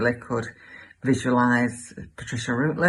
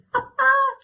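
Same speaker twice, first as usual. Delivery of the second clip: high, hammed-up titter; high comic laugh; 3 dB louder than usual. Gossip delighted by her own retort, high comic laugh. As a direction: laugh shrilly at your own joke.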